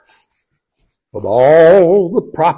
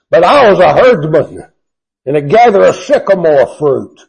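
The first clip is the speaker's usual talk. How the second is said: Amos blowing up; 3 semitones higher, 8 dB louder than usual. Acted. Amos answering the priest, loud and defiant. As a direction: let the prophet's anger boom out. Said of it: "Amos is about to blow here".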